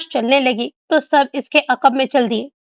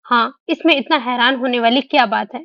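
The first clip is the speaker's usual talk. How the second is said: plain reading voice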